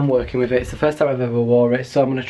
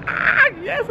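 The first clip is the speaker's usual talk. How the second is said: excited. Retold or imitated. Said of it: "I was stood there like".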